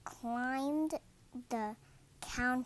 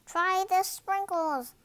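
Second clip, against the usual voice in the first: calling out; 8 semitones higher, 6 dB louder than usual. A narrator's reading. Girl in crowd calls out.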